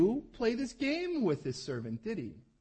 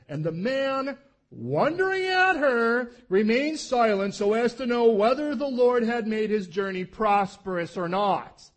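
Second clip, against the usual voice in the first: loud, slow, solemn Bible reading; deliberate, drawn-out preacher's reading; scripture reading; 2 semitones higher, 11 dB louder, slower than usual. Acted. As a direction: read the verse out like from the pulpit. Reads scripture aloud.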